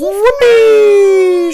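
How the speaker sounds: very high, loud, long whoop; wild storybook glee; joyful shout. A narrator's reading. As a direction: let out a huge high cheer. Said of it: "she shouts".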